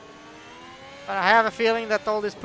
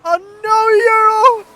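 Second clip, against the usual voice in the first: much higher, much louder shocked cry; real gasp of dismay as the car hits the wall; 12 semitones higher, 15 dB louder, slower than usual. Real, not acted. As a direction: commentator crying out in disbelief. Driver crashes, commentator cries out.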